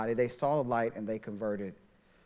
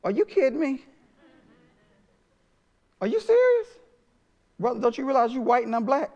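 high, incredulous inner voice, much higher and louder; shocked disbelief; 10 semitones higher, 3 dB louder than usual. Retold or imitated. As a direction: voice your private shock in disbelief, pitched way up. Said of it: "My initial reaction was".

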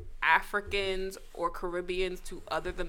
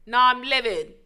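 loud, livid mock British accent, slang and all; a put-on British accent, furious about the show; 3 semitones higher, 8 dB louder, faster than usual. Cohost hears you go British, a loud, livid London put-on. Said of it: "I just said Americans can't do British accents, and here you are".

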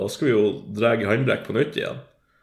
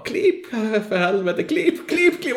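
frantic yelling; 12 semitones higher, much slower than usual. Retold or imitated. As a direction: bellow it at the driver while sliding sideways. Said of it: "eneste jeg ropet til Mika var". (desperat, høyt og lyst rop til Mika)

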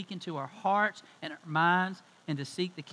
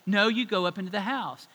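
mildly raised, insistent command; arguing mom; 3 semitones higher, 7 dB louder than usual. Retold or imitated. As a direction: overrule him like an annoyed mom, a bit louder. Mom overrules dad in quarrel.

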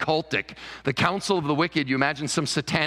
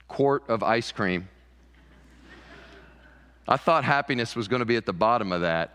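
ordinary talk

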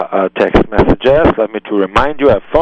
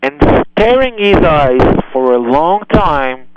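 ordinary delivery

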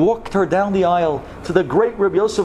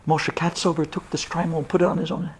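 usual speaking voice

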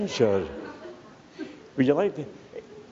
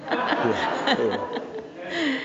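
laughing yeah; 12 semitones higher than usual. Real, not acted. Laughs at wife's accent joke.

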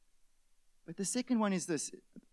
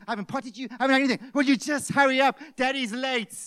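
stressed dad nagging his daughter over breakfast; harried father; 5 semitones higher, 7 dB louder than usual. Retold or imitated. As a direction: hurry the little girl along, exasperated. Father hurrying toddler in morning.

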